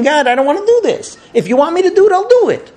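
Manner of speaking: high-pitched plea to God; pleading, rising into a cry of surrender; earnest prayer. Acted. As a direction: pray it urgently, voice climbing. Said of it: "Avram was praying".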